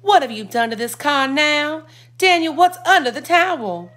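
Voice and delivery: worried. A narrator's reading. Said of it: "Mama had a worried voice".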